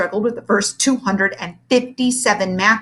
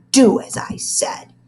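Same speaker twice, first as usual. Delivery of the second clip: stern command; 5 semitones higher, 5 dB louder, slower than usual. Acted. Teacher commands.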